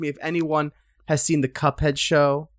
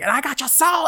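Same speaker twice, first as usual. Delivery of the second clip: high hammed-up gloating devil; mock devil gloat; 12 semitones higher than usual. Friend mocks the devil's gloating, in a high hammed-up voice. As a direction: crow it like a cartoon villain. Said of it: "be like".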